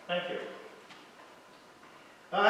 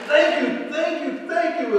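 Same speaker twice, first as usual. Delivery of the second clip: higher, eager repeated thanks; grateful thanks; 8 semitones higher than usual. Acted. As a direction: gush thanks over and over. Grateful salesmen in story.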